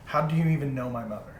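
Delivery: ordinary talk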